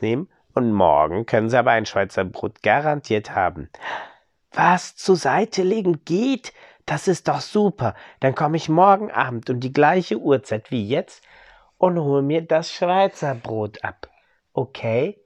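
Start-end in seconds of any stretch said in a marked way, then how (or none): 4.5-15.2 eager customer. Acted. Customer voiced in bakery story.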